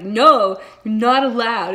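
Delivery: loud playful protest. Real, not acted. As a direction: forbid it, loud and teasing. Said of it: "But I'm like".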